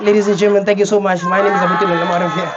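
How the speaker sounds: loud stage farewell to the crowd; stage sign-off. Acted. Comedian thanking the audience as he leaves the stage, loud. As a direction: close the set like an MC. Comedian closing his set.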